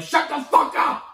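sudden high, loud snapped yell, half-joking; mock yell at kids. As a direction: blow up at screaming kids. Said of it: "and you're just like".

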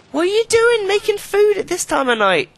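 very high, loud outraged yell; scolding shout. Real, not acted. Player scolding a Sim cooking at night, high outraged yell. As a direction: shriek at them like a furious parent.

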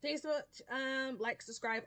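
normal talking voice

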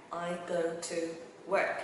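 "I go to work" slowly, word by word; slow teaching model of "I go to work"; word-by-word demo. Shown as a demonstration. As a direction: separate each word, pausing before "work".

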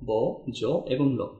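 very slow, one letter at a time with pauses; spelling out letters. Shown as a demonstration. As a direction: sound out ম, ন, র, ব, জ, ল slowly. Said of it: "এই ছয়টাই হচ্ছে ফলা".

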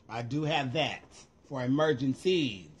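ordinary delivery

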